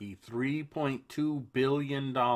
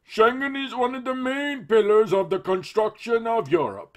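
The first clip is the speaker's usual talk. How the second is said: much higher, louder cartoon politician; silly Juncker voice; 13 semitones higher, 10 dB louder than usual. Host reads the EU president's quote in a silly voice. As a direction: lampoon him with a goofy pompous voice. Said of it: "make him sound silly".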